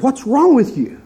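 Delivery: high, alarmed cry of the boss; a boss in a sudden panic asks what's wrong; panicked question. Retold or imitated. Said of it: "in a panic".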